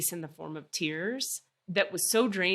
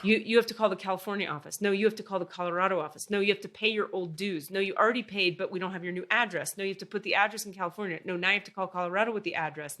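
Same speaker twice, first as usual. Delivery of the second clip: fast; faster than usual. Retold or imitated. Rattling off AAA runaround.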